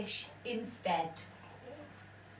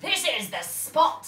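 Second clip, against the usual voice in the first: pirate captain; 5 semitones higher, 8 dB louder than usual. A narrator's reading. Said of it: "said Hank Chief".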